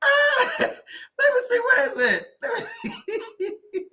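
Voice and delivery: high, slow words squeezed through laughter; laughing hard. Real, not acted. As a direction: still cracking up while searching. Laughing while looking for comment.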